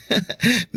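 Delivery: short high embarrassed giggle; sheepish giggle. Acted. Rodel to Henry about horror movies, sheepish high giggle. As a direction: laugh it off, a little shy.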